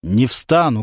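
ordinary delivery

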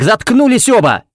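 commanding, word-by-word outburst; forceful order. A narrator's reading. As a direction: cut through the quarrel with a shout. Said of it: "чеканя каждое слово, приказал Феникс".

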